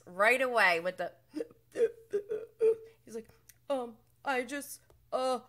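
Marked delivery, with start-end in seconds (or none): 3.7-5.4 higher, halting mock acceptance speech; hammed-up teary thank-you impression; mock award speech. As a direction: stammer through thanks like a fake award winner. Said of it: "as if he's, like, accepting an award".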